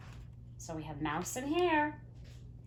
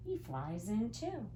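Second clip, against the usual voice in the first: usual speaking voice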